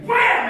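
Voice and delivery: shouted high punch sound effect; loud, high punch sound; loud wham. Acted. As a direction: slam it out like a punch landing. Acting out punching Pastor Dan.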